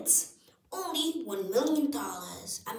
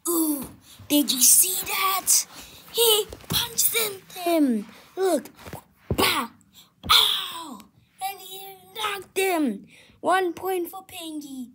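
excited commentator; 9 semitones higher, 7 dB louder than usual. Acted. Live fight commentary.

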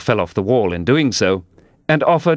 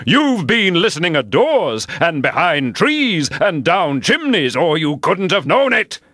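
high, accusing egg voice; indignant accusing; 9 semitones higher than usual. A narrator's reading. Humpty Dumpty accusing Alice of eavesdropping, indignant. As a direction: accuse her hotly. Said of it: "breaking into a sudden passion".

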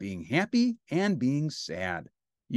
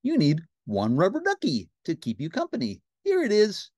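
cartoon pal, rising higher and louder; Ernie; 5 semitones higher, 4 dB louder than usual. Ernie hands over a bath toy, eager cartoon voice. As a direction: offer it eagerly, voice climbing. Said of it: "said Ernie".

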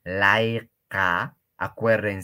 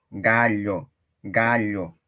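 ordinary talk, nothing marked

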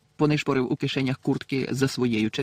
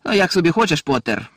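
sinister sneer; 4 semitones higher, 6 dB louder than usual. A narrator's reading. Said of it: "лиховісно вишкірився Мелфой".

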